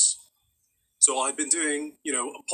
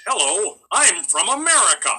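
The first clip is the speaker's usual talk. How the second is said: exaggerated American accent, pitched high; 6 semitones higher, 3 dB louder than usual. British actor playing an American, hammed-up American accent. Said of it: "The way that a lot of British actors do an American accent".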